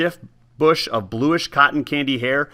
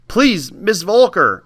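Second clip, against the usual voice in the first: higher, slow quavering plea; 7 semitones higher, 4 dB louder, slower than usual. Acted. Boy begging the old woman, quavering plea. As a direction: plead with a shaking voice. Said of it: "I said with my voice quavering".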